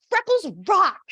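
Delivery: high, loud, bubbly cheer; great enthusiasm. Retold or imitated. Tyra as a doll cheering about freckles, imitated with high, loud enthusiasm. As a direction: gush it like a delighted doll. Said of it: "with such enthusiasm".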